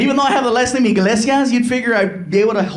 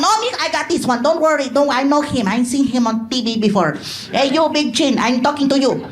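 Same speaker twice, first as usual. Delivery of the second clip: higher-pitched Mexican mother taking charge; cocky mother voice, pitched up; feisty mom; 4 semitones higher than usual. Acted. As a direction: brush off the son, then call out the host. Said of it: "my mom was like".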